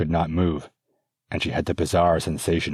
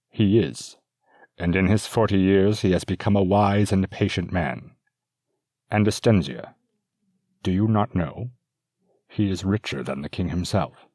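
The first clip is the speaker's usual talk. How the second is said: patient father persuading; 4 semitones higher, 3 dB louder than usual. Acted. Father praises the vizier.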